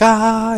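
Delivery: high mock scream; a short joking yell, the marine in heaven; imitated scream. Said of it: "just start screaming constantly".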